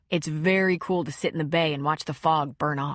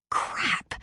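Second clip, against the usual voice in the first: whispered curse; 3 dB quieter than usual. Acted. Muttered aside to herself.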